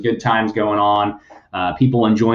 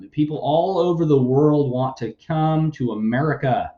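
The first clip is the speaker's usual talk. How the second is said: normal talking voice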